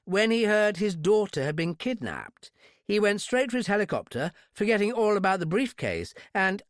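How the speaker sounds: high-pitched affected English explanation; exaggerated British accent. A narrator's reading. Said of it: "high-class English accent".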